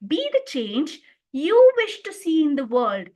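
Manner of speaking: YOU punched after a small break; stress on you. Shown as a demonstration. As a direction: make you the strongest word. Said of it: "I lay the stress on the fourth word".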